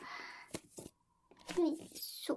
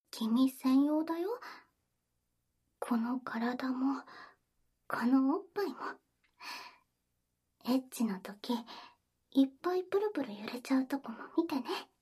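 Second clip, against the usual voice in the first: whispering; 4 semitones lower than usual. Acted. Intimate whispered roleplay. (甘くささやく)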